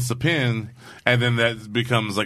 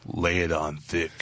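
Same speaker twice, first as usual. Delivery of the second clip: lay it on thick; 7 semitones lower, 6 dB quieter, slower than usual. Retold or imitated. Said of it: "then the next week they're like".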